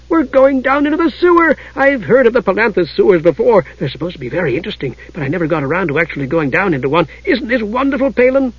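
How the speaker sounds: high, eager kender chatter; excited Tass. A narrator's reading. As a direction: gush with delight in a high voice. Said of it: "in excitement".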